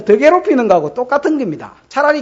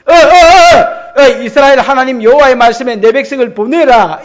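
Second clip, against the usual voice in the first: stammering Moses; 3 semitones higher, 9 dB louder than usual. Acted. 모세가 바로 앞에서 더듬으며, 크게 외치듯. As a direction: stumble over the words, then blurt it out.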